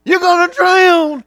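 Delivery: high, loud panicked yell, repeated; grandmother screaming. Retold or imitated. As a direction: shriek the warning twice in alarm. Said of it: "my grandmother, I can hear".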